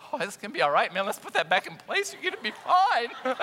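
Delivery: loud, high, forced calm; hammed-up frantic comfort; mock panicked reassurance. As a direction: reassure a hurt kid while panicking. Calming the bleeding boy.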